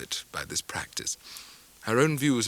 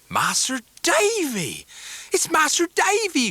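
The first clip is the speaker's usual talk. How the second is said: high, joyful calling out; cried out; 4 dB louder, slower than usual. A narrator's reading. Ham greeting old friend at door, excited cry. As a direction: call out in delight at the door. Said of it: "cried Ham".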